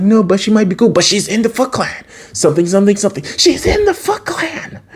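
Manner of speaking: very high, squealed mock argument; hammed-up pleading back and forth; acted exasperated protest. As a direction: whine it in an exaggerated high voice. Acting an argument over the girl.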